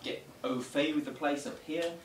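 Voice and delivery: ordinary talk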